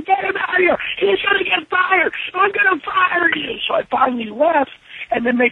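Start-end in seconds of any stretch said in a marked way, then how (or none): 0.0-3.6 high, panicked mock rant; imitating Vince freaking out at the talent. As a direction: rant like a boss losing it. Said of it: "Vince freaking out and going".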